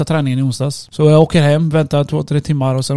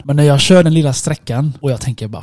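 ordinary delivery

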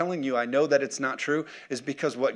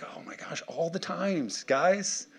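hushed mock exasperation, rising on the last word; weary exasperation; 4 semitones higher, 5 dB quieter, slower than usual. Jesus to people who panic every storm, exasperated. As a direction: sigh it out under the breath, fed up. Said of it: "he's like".

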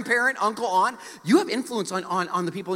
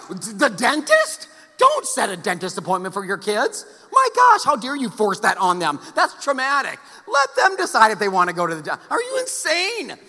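sarcastic mock outrage; 2 semitones higher, 5 dB louder than usual. Pastor mocking parents about the dentist, hammed-up outrage. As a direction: ham up the outrage for laughs.

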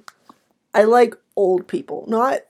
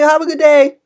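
higher, louder chirpy farewell; cheerful cashier voice; 6 semitones higher, 7 dB louder than usual. Retold or imitated. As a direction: fake brightness despite being angry. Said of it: "but I'm still like".

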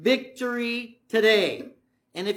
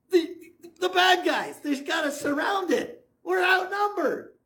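high, slow, stammering mock panic; a frantic servant reporting the siege; freaking out; 8 semitones higher than usual. Gehazi telling Elisha they are surrounded, panicked. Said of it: "he's freaking out".

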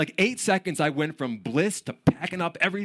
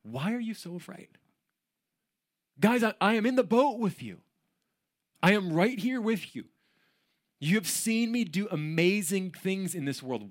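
higher, lightly voiced pleading friend; Jesus pleading; 6 semitones higher than usual. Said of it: "He said to his disciples".

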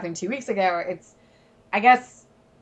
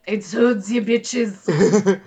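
bad German accent; lightly put-on German accent; 3 semitones lower, much slower than usual. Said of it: "I was trying to do a German accent".

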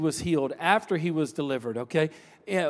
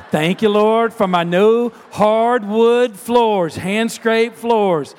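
loud, slow, drawn-out thanks; claiming the blessing out loud, word by word; fervent praise; 3 semitones higher, 8 dB louder, slower than usual. Retold or imitated. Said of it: "she started claiming it".

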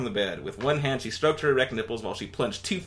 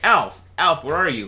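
raised voice calling for Alf through the house; 5 semitones higher, 7 dB louder than usual. Acted. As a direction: call out loudly to someone in another room.

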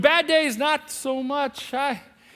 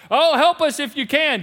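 mock plea; 5 semitones higher, 6 dB louder than usual. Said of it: "Can you imagine how that must have played out".

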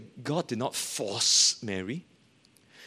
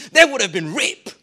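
loud, higher, hard-hitting; said very loudly; 5 semitones higher, 8 dB louder than usual. Retold or imitated. Preacher quoting her lecturer, loud and high. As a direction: let the word land loud. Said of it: "she said this very loudly".

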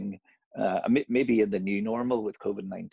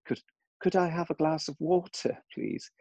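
put-on higher-pitched jokey English accent; mock English accent; 9 semitones higher than usual. Parent imitating the kids asking for water, mock English accent. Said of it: "say with an English accent".

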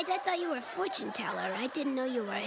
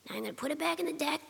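ordinary talk, nothing marked

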